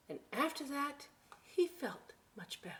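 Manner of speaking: whispered storybook line; whispered read-aloud. Acted. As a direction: breathe it out in relief for the kids. Reads picture book aloud.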